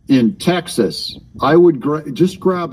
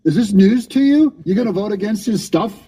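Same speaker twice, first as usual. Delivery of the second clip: mocking taunt; 7 semitones higher than usual. Real, not acted. Mocking the caller's threat.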